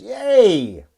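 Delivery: high, loud drawn-out cheer; mock cheer. Cheer after quoted sign-off.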